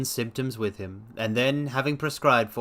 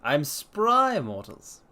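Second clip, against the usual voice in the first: hammed-up old man shouting he's spry; grandpa voice; 4 semitones higher, much slower than usual. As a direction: grandpa boasting to the mortals, much higher. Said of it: "Grandpa voice?".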